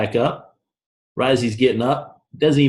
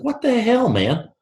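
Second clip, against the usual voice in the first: outraged cry; 14 semitones higher than usual. Acted. Beaten kid cries out in story.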